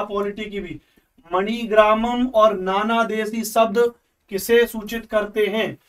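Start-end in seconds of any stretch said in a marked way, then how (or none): 1.3-5.8 प्रश्न को धीरे और साफ पढ़ना; slow question read. Shown as a demonstration. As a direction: read the question slowly, word by word. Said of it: "question read out". (धीमी, स्पष्ट प्रश्न-वाचन)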